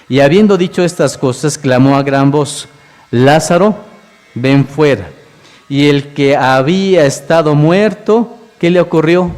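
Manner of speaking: fervent preaching. Acted. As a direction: preach the miracle to the congregation. Preaches the raising of Lazarus.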